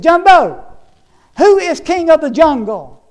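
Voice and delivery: very loud, high-pitched bellow; roaring lion. Acted. Lion challenging a bull elephant, roaring shout. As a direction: roar it out as a full-force challenge. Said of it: "he let out a roar".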